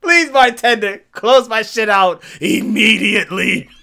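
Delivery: loud mock eager bar exit; eager rush. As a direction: blurt it out like you can't leave fast enough. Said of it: "I was ready to be like".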